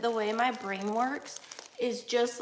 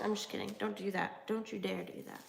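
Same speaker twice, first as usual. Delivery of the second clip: ordinary talk, nothing marked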